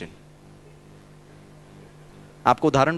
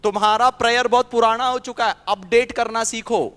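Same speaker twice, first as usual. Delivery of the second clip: higher, louder voice of a man from the audience; man in the audience teasing the speaker, in a higher, louder voice; 7 semitones higher, 3 dB louder than usual. Retold or imitated. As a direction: play the heckler telling the speaker to update his prayer. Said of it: "सभा में से एक भाई साहब ने कहा".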